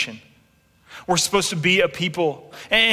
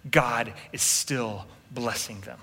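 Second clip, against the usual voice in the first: ordinary delivery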